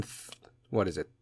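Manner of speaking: ordinary talk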